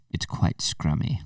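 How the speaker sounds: soft, slow mock-British verdict; imitating niece's British accent. Niece commenting while eating, imitated British accent. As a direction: quietly savour it like a British baking judge. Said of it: "she goes like this".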